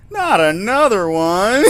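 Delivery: high, loud, slow wail of protest; mock despair. Real, not acted. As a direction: howl it out, half laughing, half crying. Player protests another form.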